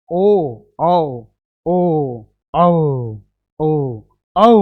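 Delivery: teacher contrasting ओ and औ; short and long vowel pair. Shown as a demonstration. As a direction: snap ओ, draw out औ. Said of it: "औ तो काफी खतरनाक".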